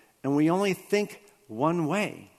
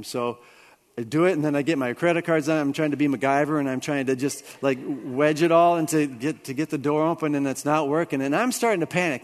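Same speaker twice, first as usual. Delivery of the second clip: normal talking voice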